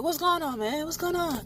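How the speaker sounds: quiet, puzzled asking; soft concern. Retold or imitated. Xander arriving to check on the group, softly concerned. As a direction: ask softly what happened. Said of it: "He's like".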